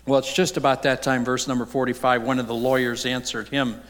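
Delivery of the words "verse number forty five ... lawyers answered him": ordinary talk, nothing marked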